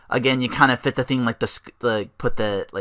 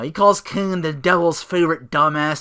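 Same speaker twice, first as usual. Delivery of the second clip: ordinary delivery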